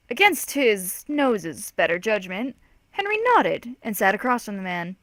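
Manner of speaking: plain reading voice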